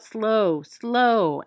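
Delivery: ordinary delivery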